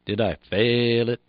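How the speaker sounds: three short syllables round one long, held "fail". Shown as a demonstration. Instructor shows learners the rhythm of the code, "fail" held long. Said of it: "The word fail is the only long beep".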